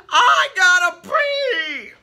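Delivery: high, loud, slow yell; desperate shout. Acted. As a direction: burst out in desperation. Giraffe desperate outburst.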